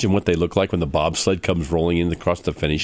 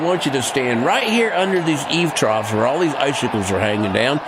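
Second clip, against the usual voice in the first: raised, higher calling voice; 6 semitones higher than usual. Acted. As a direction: call it out like a ringmaster. Staging a stunt, calling out.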